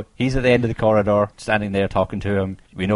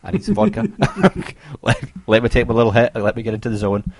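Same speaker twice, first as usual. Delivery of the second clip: mock eager medium; 5 semitones higher, 6 dB louder than usual. Medium demands vodka, voiced by friend.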